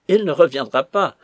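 loud, emphatic contradiction; insolent retort. A narrator's reading. Lackey contradicting his mistress about the colonel, insolent. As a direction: hit back bluntly.

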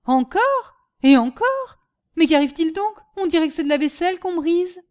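loud, high startled questions; alarmed. Acted. Master hearing crockery smash below, alarmed. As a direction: react to crash after crash.

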